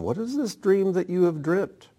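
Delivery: lightly voiced father scolding his son. A narrator's reading. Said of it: "His father scolded him".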